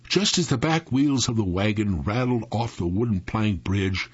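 ordinary delivery

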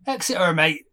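broad West Country pirate twang on Exeter, mate; West Country accent. Retold or imitated. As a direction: Dalek answering like a Devon local. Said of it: "the accent we were trying to do there".

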